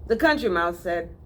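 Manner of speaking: ordinary delivery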